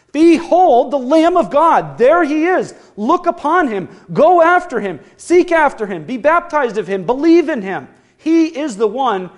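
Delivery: loud, high, fervent crying out; preached proclamation. Retold or imitated. Preacher voicing John the Baptist crying out to the crowd. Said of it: "he was shouting out".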